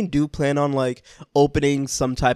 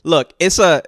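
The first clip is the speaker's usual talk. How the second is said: ordinary delivery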